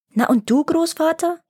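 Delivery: put-on a curious little boy asking grandfather; eager child's question. A narrator's reading. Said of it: "fragte Tjovik".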